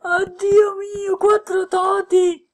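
high, loud thrilled exclaim; excited cry. Real, not acted. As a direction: yell out the count in joy. More top players appear.

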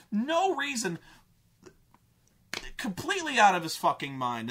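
ordinary talk

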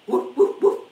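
loud barking woofs; dog bark. Shown as a demonstration. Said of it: "And the dog goes".

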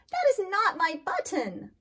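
loud, high, wailing complaint. Acted. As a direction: wail over the lost button. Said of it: "wailed Toad".

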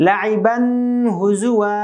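"লাইবান হুজুয়া" with the final n of লাইবান held in a long hum; mistaken ghunnah. Shown as a demonstration. Teacher showing learners a mistake, "লাইবান হুজুয়া" with a drawn-out nasal n. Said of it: "এটা ভুল কিভাবে হবে?".

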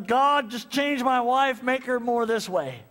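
whiny prayer. Retold or imitated. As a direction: drag out the demand. Retold complaining prayer.

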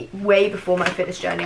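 ordinary talk

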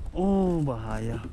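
usual speaking voice

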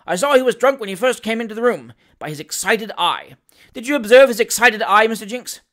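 loud, high, insistent assertion; self-righteous indignation from the bench; irate, pompous magistrate. Acted. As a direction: press the clerk to back the accusation. Voices irate magistrate Nupkins.

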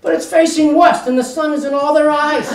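ordinary talk

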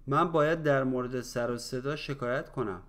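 quick, run together in one breath; natural conversational speed. Shown as a demonstration. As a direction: say it fast like everyday talk. Same phrase at natural speed. (سریع و روان، با سرعت محاوره)

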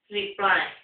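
plain reading voice

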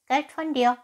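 high, loud cat echo; hissing cat. Acted. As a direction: throw it back as a high, hissy cat. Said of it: "zischte die Katze".

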